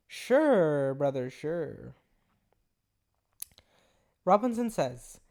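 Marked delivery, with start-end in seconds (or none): 0.1-2.0 drawn-out, dismissive fake agreement; sarcastic disbelief. Real, not acted. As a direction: stretch it out, humouring an obvious lie. Scoffs at the message just read.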